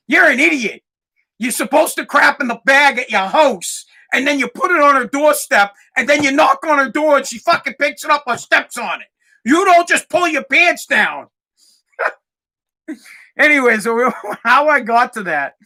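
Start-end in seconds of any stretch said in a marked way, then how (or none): 0.1-11.3 high, loud lecturing mom voice; mom scolding. Retold or imitated. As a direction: explain it like a mom fed up with him. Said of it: "She's like".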